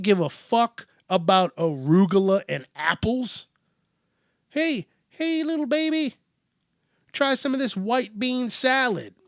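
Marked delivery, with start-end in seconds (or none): 4.5-9.1 very high mock baby talk; cooing parent. Parent offering bean salad to a baby, high cooing. Parent coaxing a child again.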